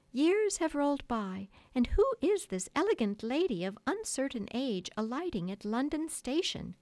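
high, loud theatrical declaiming; grand storytelling effort. A narrator's reading. Wendy performing the future for the boys, grandly theatrical. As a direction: declaim it with sweeping pitch. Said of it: "bracing herself for her finest effort".

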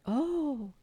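high, loud drawn-out swoon; awed "oh". Acted. Boys hearing Wendy's reveal, awestruck. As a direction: exclaim in long wonder.

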